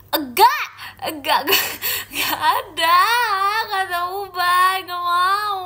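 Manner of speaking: high, loud drawn-out wail; whining denial. Real, not acted. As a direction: wail in playful refusal. Whining denial of grey hair. (merengek keras tidak mau)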